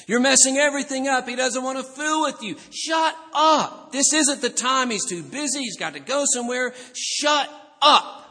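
high, loud, hammed-up scolding; exasperated rebuke. Crowd telling the beggar to stop bothering Jesus, shouted rebuke. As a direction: scold fast, bursting into shouts. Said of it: "they were rebuking him".

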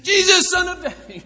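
high, full-force holler; sudden outburst. Acted. Beggar lurching back to call Jesus, sudden comic shout. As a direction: burst out shouting, all at once. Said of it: "suddenly lurch back and say".

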